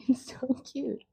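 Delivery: breathy whisper of delight. Real, not acted. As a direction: whisper it, charmed. Dog nibbling her nose.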